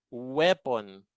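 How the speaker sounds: "Weapon" with a stretched oo onset. Shown as a demonstration. Teacher gives an example of W, "Weapon" begun on a long oo. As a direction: begin "Weapon" as oo-eh-pon. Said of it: "Ano yung bigkas sa W".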